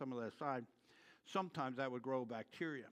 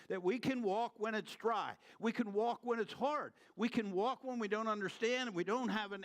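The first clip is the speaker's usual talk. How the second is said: higher, louder impassioned preaching; preaching at full force; 8 semitones higher, 4 dB louder than usual. Acted. Preacher urging his congregation to keep trusting, impassioned preaching. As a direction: build the promise with fervour, slowing on the key lines.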